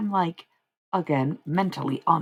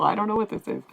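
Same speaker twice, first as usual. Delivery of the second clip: ordinary talk, nothing marked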